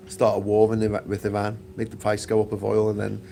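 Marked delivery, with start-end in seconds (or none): none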